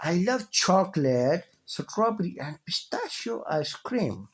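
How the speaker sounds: slow, "chocolate" up and "pistachio" down; rise-fall intonation. Shown as a demonstration. Teacher reading a list example for rise-fall intonation. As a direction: lift on "chocolate", fall on "pistachio", read slowly. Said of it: "pistachio पे यहाँ पे आवाज़ down होगी और chocolate पे आवाज़ ऊंची होगी".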